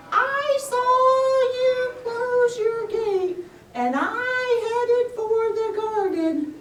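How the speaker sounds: very high, slow sing-song tortoise voice; a gentle, unhurried tortoise. Acted. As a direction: drawl it out like a dreamy old tortoise.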